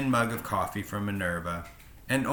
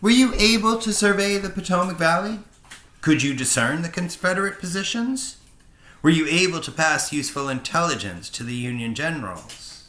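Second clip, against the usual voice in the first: a lightly voiced professor, higher and louder, pressing questions; eager old professor; 7 semitones higher, 5 dB louder than usual. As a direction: quiz the pilot with keen interest.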